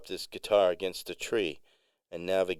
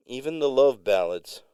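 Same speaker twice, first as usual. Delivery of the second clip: "love" emphasized, high and loud; stress on LOVE; 7 semitones higher, 7 dB louder than usual. A narrator's reading. Musician boasting to a fan, stress on "love". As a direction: lean hard on the word "love". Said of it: "while emphasizing the word love".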